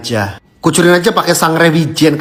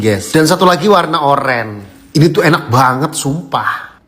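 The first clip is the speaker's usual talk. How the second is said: ordinary talk, nothing marked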